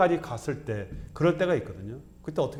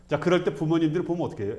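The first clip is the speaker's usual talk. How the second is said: ordinary talk, nothing marked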